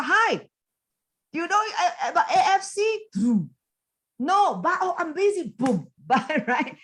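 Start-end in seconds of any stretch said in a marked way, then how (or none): 0.0-3.0 high-pitched, over-eager mock sales call; kiasu cold caller. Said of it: "like kiasu". 4.2-5.5 high-pitched imitation of a busy prospect; mock brush-off. Acting the prospect brushing caller off.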